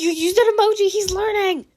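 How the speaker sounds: high, put-on teasing voice; teasing character voice. Mei teasing Shinzo about his emoji, high and playful. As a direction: tease him like a proud friend.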